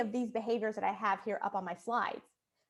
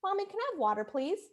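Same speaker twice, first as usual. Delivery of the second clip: higher, sweet asking voice; polite child; 7 semitones higher than usual. Retold or imitated. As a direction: ask nicely like a well-mannered little girl. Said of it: "So she would resort to".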